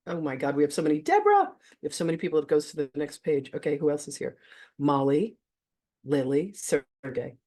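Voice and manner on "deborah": loud, high-pitched happy cry; excited greeting shout. Real, not acted. Host suddenly spotting another guest, excited high shout. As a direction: burst out with the name, very high. Calling out an attendee's name.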